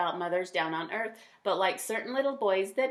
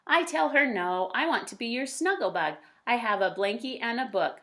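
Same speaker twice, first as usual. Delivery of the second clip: lively, louder storybook voice; reading to children; 2 semitones higher, 4 dB louder than usual. A narrator's reading. A parent reading a picture book aloud to kids, lively. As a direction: read it brightly to young listeners. Reads child narrator Max.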